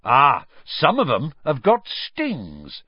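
keen creature lover explaining; enthusiastic. A narrator's reading. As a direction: savour it with delight. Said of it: "said Hagrid enthusiastically".

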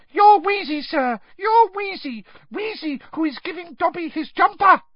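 loud, very high elf squeal; high-pitched agitated elf; Dobby. A narrator's reading. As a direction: squeak it with frantic insistence. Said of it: "squeaked Dobby".

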